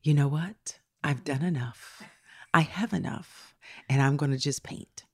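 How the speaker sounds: slow, soft, low declaration; serene resignation. Retold or imitated. Host voices someone content to quit, serene. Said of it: "nowhere near ever saying".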